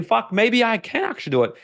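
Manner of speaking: high, surprised imitation of a newly convinced person; mock realization. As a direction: act out the moment doubt flips to belief. Brainwashed person's sudden realization.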